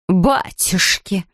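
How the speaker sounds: very slow drawn-out exclamation; startled cry. A narrator's reading. Mrs Bird sees the bear under the wreckage, drawn-out cry. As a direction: stretch it out in shock. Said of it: "вскричала миссис Бёрд".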